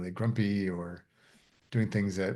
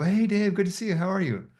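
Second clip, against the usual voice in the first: high, louder upbeat hello; cheery greeting; 10 semitones higher, 3 dB louder than usual. Retold or imitated. Student greeting a classmate on the walk to class, cheerily. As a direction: greet a passing classmate, pitched high and friendly.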